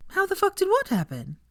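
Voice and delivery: high, light voice of feigned innocence; innocent. A narrator's reading. Hermione to Millicent, high mock-innocent echo. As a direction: play dumb sweetly. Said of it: "asked Hermione innocently".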